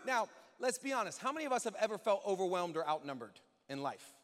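ordinary talk, nothing marked

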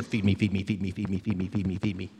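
a lightly voiced needy churchgoer; whiny demanding consumer. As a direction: congregant demanding to be fed, mock droning chant. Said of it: "in this chair just going".

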